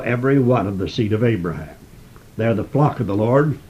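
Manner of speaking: usual speaking voice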